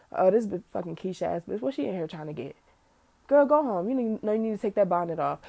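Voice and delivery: hushed scornful inner voice; snide muttered thought. Retold or imitated. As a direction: sneer it under your breath. Voicing a prejudiced inner thought.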